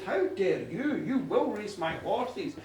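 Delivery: ordinary delivery, nothing marked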